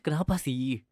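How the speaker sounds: ordinary delivery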